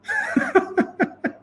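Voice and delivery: mocking laugh. Mimics soldiers laughing at him.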